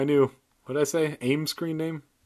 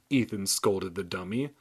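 ordinary delivery, nothing marked

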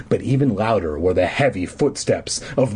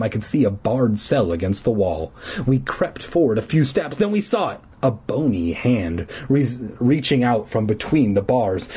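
ordinary delivery

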